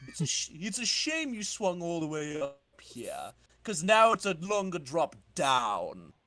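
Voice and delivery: lightly put-on villain accent, slowing at the end; Rocket Racer accent. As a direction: taunt the hero in a hammy comic-villain voice. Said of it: "That's the accent I'm gonna be doing for Rocket Racer".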